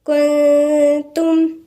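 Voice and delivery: كنتم drawn out slowly with a light nasal ghunna; كنتم recited with a thin nasal hum; tajweed demo. Shown as a demonstration. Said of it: "فهنقولها ازاي؟".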